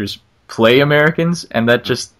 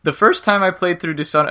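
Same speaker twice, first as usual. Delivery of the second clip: excited voice cracking; 5 semitones higher than usual. Real, not acted. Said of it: "my voice just cracked there 'cause I got excited".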